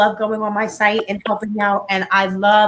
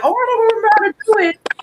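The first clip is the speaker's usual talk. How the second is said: higher-pitched imitation of feigned cluelessness; mock forgetfulness; 11 semitones higher, 4 dB louder, faster than usual. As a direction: play the excuse-maker, voice lifted. Mimicking people feigning forgetfulness.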